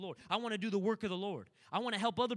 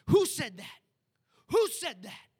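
loud, higher preacher call to the crowd; booming question; 5 semitones higher, 11 dB louder, slower than usual. Retold or imitated. Preacher calling out to his congregation, booming and higher. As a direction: throw the question out to the whole church. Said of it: "I said".

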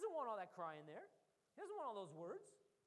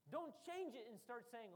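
ordinary talk

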